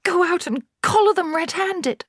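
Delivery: very high, insistent order; urgent mother. A narrator's reading. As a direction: order the timid husband out. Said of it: "hissed the mother".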